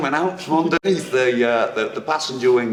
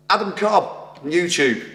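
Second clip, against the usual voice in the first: normal talking voice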